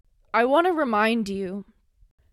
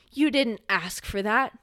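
usual speaking voice

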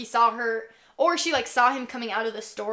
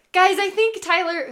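excited; 8 semitones higher, 8 dB louder than usual. Retold or imitated. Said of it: "she's like".

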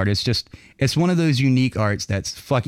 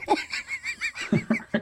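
high squealing laugh; 8 dB quieter than usual. Real, not acted. Laughs at own innuendo.

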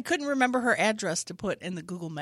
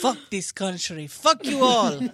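mock raging dad; 5 dB louder than usual. Voicing Igor raging.

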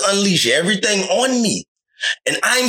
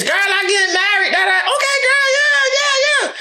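put-on squealing girlfriend; 14 semitones higher than usual. Woman telling her girlfriends she's getting married, mock high squeal. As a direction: ham up a giddy girlfriend gushing. Imitating women gossiping with friends.

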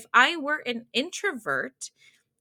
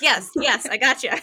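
excited yes; 3 semitones higher, 8 dB louder than usual. Retold or imitated. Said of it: "So I'm like".

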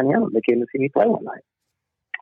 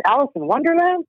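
mock puzzled question; 13 semitones higher than usual. [name] mishearing the name.

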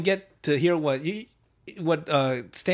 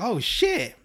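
higher-pitched thrilled exclamation; a giddy little outburst, imitated; acted excitement; 7 semitones higher than usual. Boy invited to meet his crush, acted excitement. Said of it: "So he's all excited".

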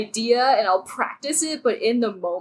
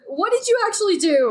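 mock confused; 10 semitones higher than usual. Mocking confused player at table.

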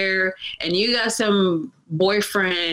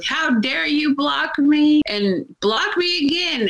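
higher, slow indignant imitation; the blocked troll whining, higher and slower; mock outraged troll; 8 semitones higher than usual. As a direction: drag it out in a higher huffy mock voice. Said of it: "came back at me like".

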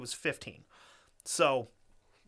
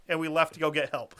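ordinary talk

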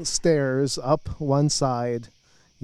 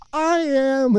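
put-on eager girl voice; 12 semitones higher, 4 dB louder than usual. Girl's eager reply voiced.

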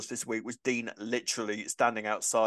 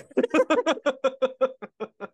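high, loud burst of laughter; 12 semitones higher, 6 dB louder than usual. Real, not acted. Laughing after teasing exchange.

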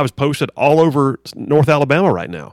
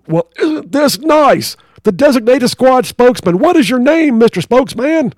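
drill sergeant; 10 semitones higher, 6 dB louder than usual. Acted. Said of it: "drill sergeant".

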